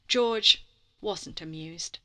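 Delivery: ordinary delivery, nothing marked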